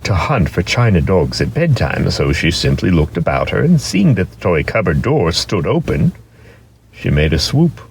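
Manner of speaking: plain reading voice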